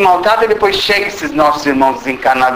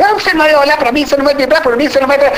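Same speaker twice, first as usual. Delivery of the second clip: namorada em crise; 7 semitones higher, much faster than usual. Retold or imitated.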